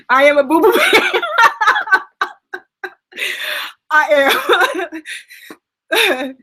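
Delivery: high, loud giggly self-tease; laughing tease. Real, not acted. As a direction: tease yourself as a crybaby. Teasing herself after crying.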